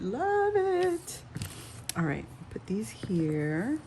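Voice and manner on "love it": squealed high with delight; gushing. Real, not acted. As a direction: swoon over it, pitch way up. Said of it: "I love it".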